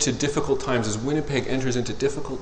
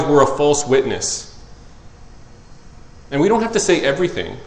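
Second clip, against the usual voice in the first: full-force preaching; 3 semitones higher, 6 dB louder than usual. Real, not acted. Pastor charging his church, loud preaching. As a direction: preach it out over the congregation.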